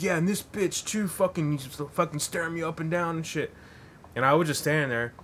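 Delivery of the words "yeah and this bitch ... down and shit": high, raised mock grumble; imitating an angry drunk, pitched up; mock complaint. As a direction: gripe about being stared at. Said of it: "He's like".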